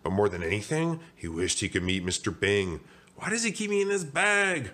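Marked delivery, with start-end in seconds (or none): 3.2-4.7 high, plaintive donut voice; high, put-out question; puzzled wondering. Acted. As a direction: wonder aloud, pitched way up. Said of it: "Arnie wondered".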